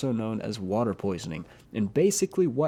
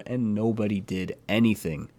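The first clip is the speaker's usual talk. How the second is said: ordinary delivery, nothing marked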